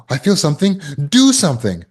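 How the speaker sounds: high, urgent rush to act; frantic survivor. Retold or imitated. Trauma survivor blurting out a rush to act. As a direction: blurt it like panic needing relief. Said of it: "many trauma survivors just".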